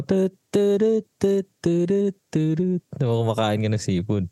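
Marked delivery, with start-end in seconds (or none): 0.0-2.8 vocal background music. Retold or imitated. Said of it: "Tapos yung background mo".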